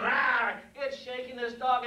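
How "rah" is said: mock dog roar; comic savage-dog noise; imitated dog attack snarl. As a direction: growl out a sudden attack bark. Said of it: "it's going".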